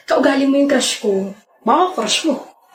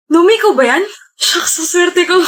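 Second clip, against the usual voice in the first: delighted surprise; 7 semitones higher than usual. Acted. Applicant recognizing old acquaintance as interviewer, delighted surprise. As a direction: burst out in delighted disbelief.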